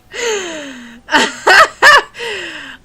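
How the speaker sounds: loud high burst of laughter; big laugh. Real, not acted. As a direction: crack up at the giant sandwich. Laughing at a huge sandwich.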